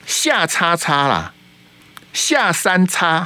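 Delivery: very slow, drawn-out bleeped-out 下叉叉; slow censored word. Real, not acted. Host dodging the regulator's censors, slow teasing self-censorship. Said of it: "那个我不能再念".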